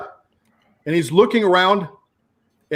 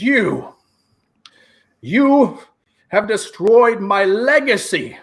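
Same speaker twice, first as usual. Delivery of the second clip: high, menacing little villain; 5 semitones higher, slower than usual. Acted. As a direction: sneer it as the vengeful halfling. GM voices halfling villain.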